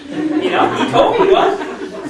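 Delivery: bursting into laughter mid-brag; laughing high and loud through the words. Real, not acted. Giddy over his new recruit, laughing hard.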